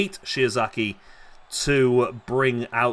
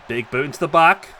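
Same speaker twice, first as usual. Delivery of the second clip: lively ringside wrestling call; wrestling commentator calling the match live; live play-by-play; 3 semitones higher, 6 dB louder than usual. Acted. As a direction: call every move as it lands.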